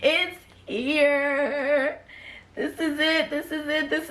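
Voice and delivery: high, thrilled exclamations; giddy, can't believe it's here; real thrill, voice up high; excited. Real, not acted. Author unboxing first book.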